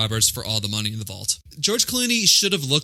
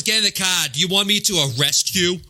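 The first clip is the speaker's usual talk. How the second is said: higher New York cop bark; a touch of New York cop; New York accent; 5 semitones higher than usual. Retold or imitated. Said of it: "he says".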